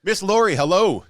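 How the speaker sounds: high, loud welcome call; loud greeting. Real, not acted. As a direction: call out a big hello to her. Greeting arriving guest loudly.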